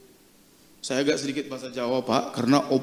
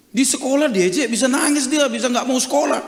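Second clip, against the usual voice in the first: acted grandparent's objection, high and loud; worried protest; 9 semitones higher, 5 dB louder than usual. Grandparent warning the pastor about teasing, worried protest. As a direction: plead the grandchild's case, pitched up.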